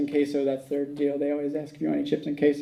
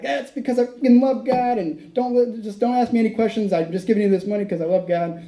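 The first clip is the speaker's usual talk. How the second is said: raised, high-pitched retold outburst; agitated cussing; 5 semitones higher, 3 dB louder than usual. Flustered giver to waiter about the money, agitated cussing. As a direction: blurt it out, worked up and defensive. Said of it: "I start cussing".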